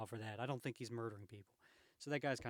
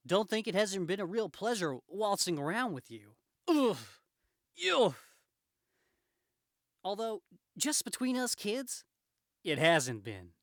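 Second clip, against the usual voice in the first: higher, louder, playful hero voice; wisecracking Spider-Man; 8 semitones higher, 12 dB louder, slower than usual. Acted. Spider-Man waltzing crooks around, teasing. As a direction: quip between punches with mock courtesy.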